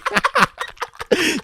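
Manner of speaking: high squeal of laughter; burst of laughter. Real, not acted. Friend cracks up at his Chinese trick.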